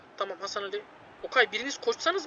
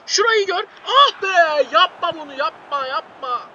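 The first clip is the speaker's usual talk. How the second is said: ordinary talk, nothing marked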